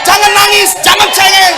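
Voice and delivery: high shouted rebuke on "Jangan nangis, jangan cengeng"; loud scolding. Acted. Pastor scolding the crybabies in the church, loud and high. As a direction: yell at them to stop crying, high and loud.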